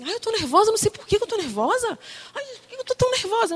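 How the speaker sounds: high, fast, worked-up whine; mock nervous fretting. Woman wondering why she is on edge, mock-nervous. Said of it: "às vezes eu ficava assim".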